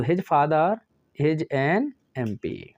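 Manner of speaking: "an MP" read slowly and evenly, word by word; slow corrected dictation. Shown as a demonstration. Teacher dictating the corrected answer, slow and deliberate. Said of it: "एन".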